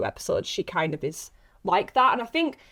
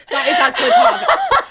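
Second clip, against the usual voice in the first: much higher and louder, laughing through it; 11 dB louder than usual. Real, not acted. Laughing as she admits the joke is true. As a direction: squeal it out in a laugh.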